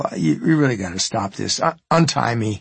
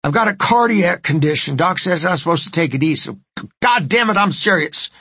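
higher, strained cries of pain; panicked pleading; 5 semitones higher than usual. Acted. Man having heart attack begs woman to call doctor, panicked. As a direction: beg for help as the chest seizes.

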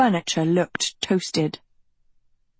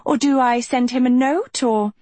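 high, insistent follow-up question; pressing; 6 semitones higher than usual. A narrator's reading. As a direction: keep pushing, pitch raised. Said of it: "pressed Sophie".

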